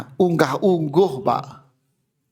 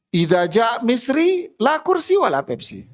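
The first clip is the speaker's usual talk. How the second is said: a lightly voiced shopkeeper sneering at Egyptians; 7 semitones higher than usual.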